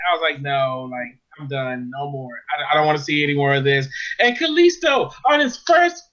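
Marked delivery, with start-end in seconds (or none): 0.4-3.9 low, weary self-quote; over it. Retold or imitated. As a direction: retell being completely done, pitched down. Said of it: "was just so over it".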